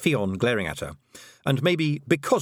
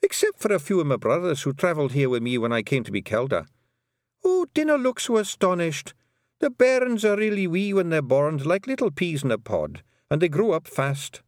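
put-on a higher old pixie mother in broad Scots, amused; old Scottish Kelda; 9 semitones higher, 4 dB louder than usual. A narrator's reading. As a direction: reassure the astonished girl, chuckling Scots. Kelda reassuring Tiffany.

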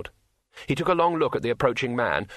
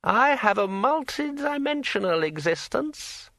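high, slow, eerie ringing voice; like a finger round a wine glass rim; ringing metallic sword voice; 12 semitones higher than usual. A narrator's reading. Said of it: "said the sword".

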